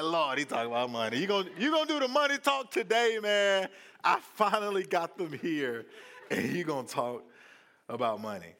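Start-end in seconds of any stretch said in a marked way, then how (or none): 0.0-5.9 high, loud, fast mock complaint; exasperated churchgoer. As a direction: whine it at the preacher, half laughing. Frustrated congregant voiced, high and loud.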